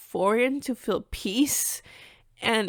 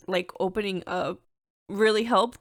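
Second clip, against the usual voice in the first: ordinary talk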